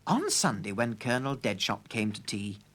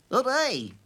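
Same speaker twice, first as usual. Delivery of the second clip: excited cheer; 10 semitones higher, 5 dB louder than usual. A narrator's reading. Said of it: "said the clockman".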